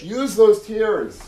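slow, loud, high and emphatic, those stretched; emphatic exhortation. Real, not acted. Preacher pleading with listeners, slow loud emphasis. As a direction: lean hard on each word, slowly.

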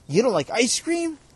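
high, loud disbelief; shocked, calling across the room; called out. Real, not acted. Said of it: "[name] called out".

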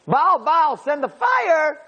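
high-pitched chanted plea, drawn out at the end; mock screaming. Prophets of Baal calling on their god for fire, mock screaming. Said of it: "we start screaming".

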